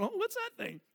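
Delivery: high, soft puzzled voice; a surprised look at the watch; mock puzzled. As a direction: play it dumb, high and soft. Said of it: "I go to bed tonight going".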